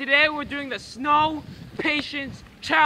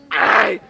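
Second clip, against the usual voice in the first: crying shout; 8 semitones lower, 3 dB louder than usual. Real, not acted. Freezing snow challenge.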